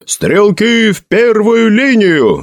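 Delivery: high, long-drawn echoed order; shouted relay. A narrator's reading. Soldiers relay the captain's order, shouting. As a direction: pass the cry down the ranks. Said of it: "закричали".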